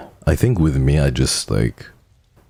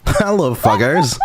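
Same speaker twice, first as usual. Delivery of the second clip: laughing; slower than usual. Retold or imitated. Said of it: "hi, fuckers".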